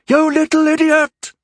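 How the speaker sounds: high, loud furious snarl. A narrator's reading. Christine snarling at her daughter, furious. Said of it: "snarled Christine".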